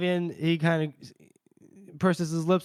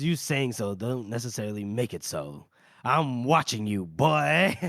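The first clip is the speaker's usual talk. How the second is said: low, menacing character voice, slowing at the end; suspicious warning; 5 semitones lower than usual. Acted. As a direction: play a wary old hand issuing a slow warning. Suspicious character warns the newcomer.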